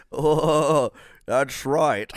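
loud mash-up of two impressions; hammed-up slushy hybrid cartoon impression; Sean Connery and Elmer Fudd. Host mocks bad impressions onstage, hammed-up mash-up voice. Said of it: "I did Sean Connery and Elmer Fudd together".

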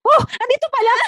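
high, loud happy cry of discovery; child's loud found-it shout; loud exclamation. Retold or imitated. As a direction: blurt it out too loud in chapel. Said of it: "malakas lang talaga yung boses ko".

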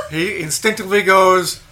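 usual speaking voice

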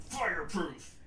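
sound effect fireproof. Pretend. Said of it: "onomatopoeia for it".

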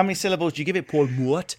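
Paul Mort in a Geordie accent. Shown as a demonstration. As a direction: give Paul Mort extra Geordie syllables. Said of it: "that is the best Geordie accent".

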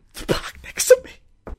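whispered mock sob; fake-cry it, breathy and broken. Driver whimpering about the car parked next to theirs.

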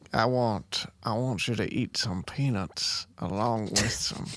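slow Southern drawl; Georgia accent. Acted. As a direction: draw it out like a Georgia peanut man. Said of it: "He's from Georgia".

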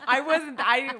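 laughing through the words; giggling while talking. Real, not acted. Laughing about the husband's song.

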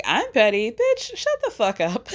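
very high-pitched indignant comeback; hammed-up indignation; mock outraged retort. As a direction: imitate a scandalized snap back, voice leaping up. Acts Candy's angry retort.

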